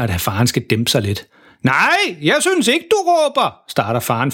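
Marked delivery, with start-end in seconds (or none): 1.6-3.5 high-pitched, oblivious yell; deafened shouting. A narrator's reading. Deafened dad denies shouting, high oblivious yell. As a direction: protest in a high holler. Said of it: "der står og råber sådan".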